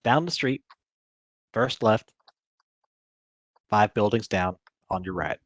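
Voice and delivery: put-on lightly southern-accented townsperson. Game master's townsperson giving directions, light southern accent. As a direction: give directions with a touch of Southern. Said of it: "I gave him a southern accent".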